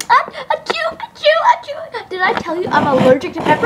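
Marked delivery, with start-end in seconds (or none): 0.1-2.1 high squeaky mock sneezes; fake sneezes. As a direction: ham up a string of sneezes.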